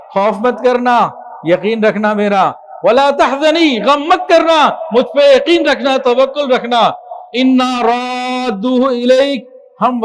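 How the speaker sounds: high, loud fervent preaching; preaching at full force. Acted. Preacher rousing his listeners with the verse, loud and fervent. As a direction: drive the words home to the crowd. God's reassurance preached loudly.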